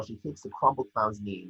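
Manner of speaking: ordinary delivery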